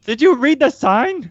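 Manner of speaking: high-pitched, drawn-out worried question; alarmed character. Acted. Nervous adventurer questions a companion about a warning, high mock alarm. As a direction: squeak it out in hammed-up alarm.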